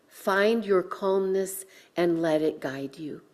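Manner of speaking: usual speaking voice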